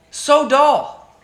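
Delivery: loud exasperated wail; exasperated cry. Real, not acted. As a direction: throw up your hands and wail "So dull". Repeats it louder, exasperated.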